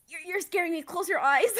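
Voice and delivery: hammed-up alarm rising in volume; mock freak-out. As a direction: imitate a spooked guy pleading. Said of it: "a little freaking out".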